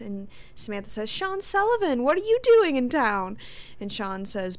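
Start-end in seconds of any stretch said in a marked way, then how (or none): 1.1-3.4 high, loud delighted surprise; acted burst of happy surprise; surprised greeting. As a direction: exclaim it like spotting an old friend.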